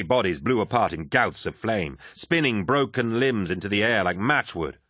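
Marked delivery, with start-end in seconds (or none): none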